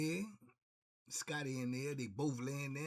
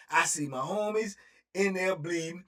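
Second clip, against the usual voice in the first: ordinary talk, nothing marked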